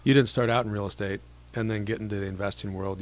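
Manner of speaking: ordinary talk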